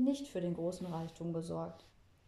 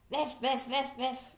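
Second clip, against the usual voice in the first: higher mock poodle yapping; yappy poodle bark; 5 semitones higher, 4 dB louder, slower than usual. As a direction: yap like a silly little dog. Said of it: "Hör doch mal, wie bescheuert".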